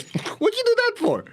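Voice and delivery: very high, startled outcry; high-pitched startled protest; shocked squeak. Retold or imitated. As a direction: yelp it like cold water just hit you. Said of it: "she goes".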